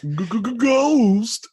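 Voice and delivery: very high stuttered ghost squeal; high squeaky ghost. Pretend. Echoes ghost bit, high.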